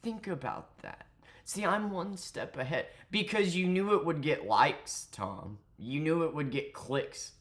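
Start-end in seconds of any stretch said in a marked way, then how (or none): 0.0-2.9 mocking impression of a smug smooth talker; mock seductive. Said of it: "proceeded to talk like this".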